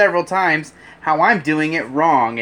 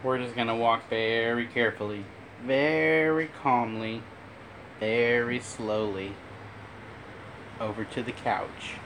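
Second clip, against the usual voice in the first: calm and soothing; 3 semitones lower, 8 dB quieter than usual. Real, not acted. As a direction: ease each word out gently, like you're calming an animal. Carrying the bird, calming it.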